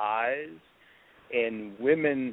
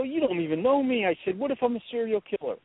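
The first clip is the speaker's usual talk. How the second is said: higher-pitched incredulous protest, re-enacted; retold warning; 9 semitones higher, faster than usual. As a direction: try to put off an admirer, voice up high. Retelling attempt to scare her away.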